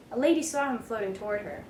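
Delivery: plain reading voice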